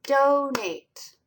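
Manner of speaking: teacher sounding out "Donate" by its two parts; syllable demonstration. Shown as a demonstration. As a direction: say "Donate" in two clear syllables, high on the first. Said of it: "Let's see how many syllables".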